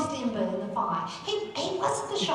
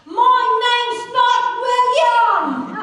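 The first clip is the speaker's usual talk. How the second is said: high, loud and very slow, every word dragged out; furious drawn-out yell; angry shout; 11 semitones higher, 9 dB louder, much slower than usual. Acted. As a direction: bellow it back up at the gallery. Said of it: "and he says".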